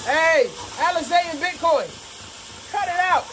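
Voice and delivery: yelling at dogs to stop; scolding the dogs. Real, not acted. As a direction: call the dogs off across the yard. Scolding dogs across the yard.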